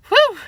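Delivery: high, loud relieved sigh; relieved whew. Acted. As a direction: let the fear go with a whew. Relief after scare.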